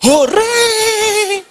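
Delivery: high, loud delighted cheer; little daughter cheering over her surprise treat; child cheering. Retold or imitated. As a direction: shout hooray like a thrilled kid. Child gets the snack boxes.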